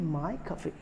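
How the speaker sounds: माय खफी with "my" first, then "kuh-fee"; correct pronunciation. Shown as a demonstration. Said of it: "इसको बोलते हैं".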